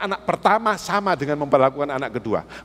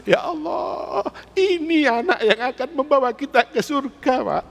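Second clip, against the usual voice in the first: weeping father; 8 semitones higher than usual. Retold or imitated. Father overcome by his son's dawn prayer, weeping. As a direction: let the tears break through, pitched up and slowed. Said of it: "Nangis saya".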